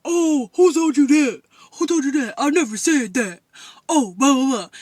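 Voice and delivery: mock her getting all fired up; loud, worked-up retold protest; mad, fired up. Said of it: "all mad and all fired up".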